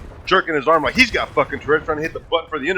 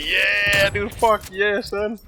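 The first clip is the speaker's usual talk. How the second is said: gleeful cheer; 6 semitones higher, much slower than usual. Retold or imitated. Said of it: "literally enjoy, just laughing".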